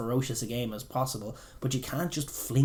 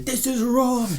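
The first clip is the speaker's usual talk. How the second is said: mock outraged commentator, much higher and louder; 13 semitones higher, 8 dB louder, slower than usual. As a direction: ham up the indignant pundit. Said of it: "That's what Maloney said on commentary".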